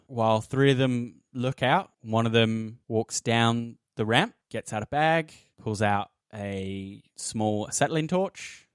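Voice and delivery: normal talking voice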